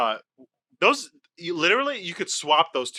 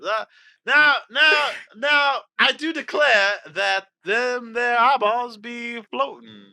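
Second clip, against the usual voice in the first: loud, high, slow Southern rooster drawl; Foghorn Leghorn; 8 semitones higher, 5 dB louder, slower than usual. Retold or imitated. Guessing a Southern phrase in a Foghorn Leghorn voice. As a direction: ham up an old cartoon Southern gent. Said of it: "this is fucking Foghorn".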